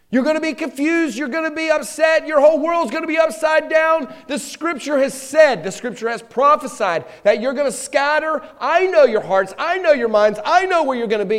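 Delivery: loud, high, urgent preaching as the Lord; fervent shout. Acted. The Lord warning his disciples, shouting. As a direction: cry out the warning with full force.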